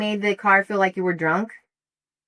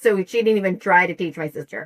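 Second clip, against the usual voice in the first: normal talking voice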